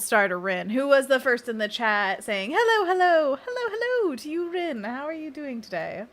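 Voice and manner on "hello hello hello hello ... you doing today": sing-song greeting. Real, not acted. Greeting first chatter in sing-song.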